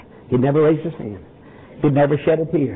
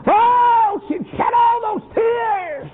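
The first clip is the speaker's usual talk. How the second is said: high, slow wailing cry; preacher's tearful climax; crying shout; much slower than usual. Acted. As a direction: wail it out with tears in the voice.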